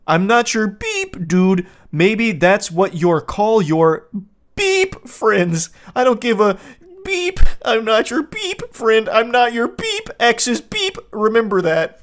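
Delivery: mock the childish boyfriend, high and loud; whiny man-child mockery. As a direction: sneer it like a spoiled boy. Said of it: "He's a baby boy".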